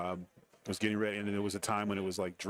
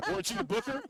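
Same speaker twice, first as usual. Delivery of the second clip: cheeky; 12 semitones higher, faster than usual. Retold or imitated. Needling his boss, joking.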